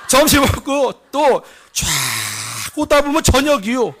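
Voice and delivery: animated yarn with stretched words; boastful cousin. Retold or imitated. Cousin describes the long march to lunch, animated imitation. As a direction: spin it out like a village storyteller. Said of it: "외사촌 형님이 삼성 다녀와서 했던 얘기".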